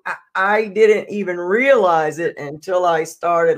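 ordinary talk, nothing marked

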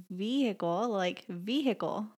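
"vehicle" said with a sounded H; H pronounced. Shown as a demonstration. Wife showing her own way, "vehicle" with the H sounded. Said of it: "I pronounce the H in it".

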